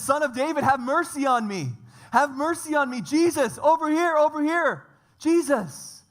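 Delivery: high, loud cry for help across a crowd; desperate shout. Acted. Blind man shouting to Jesus over the crowd, desperate loud cry. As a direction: call out to Jesus with everything you have.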